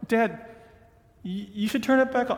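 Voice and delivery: lightly pushy son. Retold or imitated. Son presses his father again, quick and insistent.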